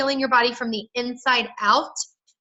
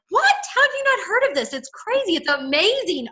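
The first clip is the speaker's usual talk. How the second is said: much higher, gushing and incredulous; amazed disbelief; 8 semitones higher than usual. Shown as a demonstration. Seller to someone who never heard of Plexus, gushing disbelief. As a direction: sell it like you can't believe they've missed it. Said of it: "So I talk like".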